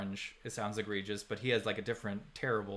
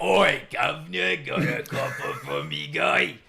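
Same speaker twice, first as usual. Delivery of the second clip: Cockney caricature; 5 semitones higher, 9 dB louder than usual. Acted. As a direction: holler like an annoying Cockney street character. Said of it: "the weird Cockney accent".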